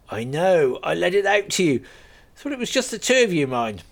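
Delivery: a friendly landowner laughing off his joke; lightly voiced farmer. Gunman farmer speaks.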